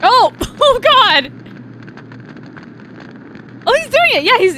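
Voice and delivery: high, loud, laughing disbelief; real excited outburst. Real, not acted. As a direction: blurt it out, thrilled and laughing. Reacts to combustion scene, laughing.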